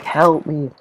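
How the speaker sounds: high, panicked cry of help me; high mock panic, not full volume; mock scream for help. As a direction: imitate a kid screaming for help. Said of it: "he was just screaming".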